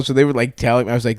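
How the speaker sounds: normal talking voice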